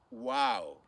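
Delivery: ordinary talk, nothing marked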